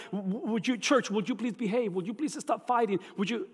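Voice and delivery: mildly pleading, stammered appeal; begging. Acted. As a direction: act out a light, hurried plea. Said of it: "begging my wife or my p".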